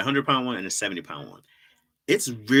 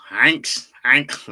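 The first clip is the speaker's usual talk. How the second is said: louder mock German accent; 3 semitones higher, 8 dB louder than usual. Asked by chat for German, he tries a hammed-up German accent. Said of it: "Try a German accent".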